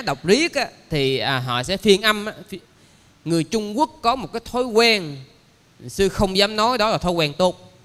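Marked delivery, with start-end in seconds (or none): none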